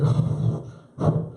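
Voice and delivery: breathy worried uh-oh; whispered uh-oh. Retold or imitated. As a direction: breathe it out in dread. Kid after drinking.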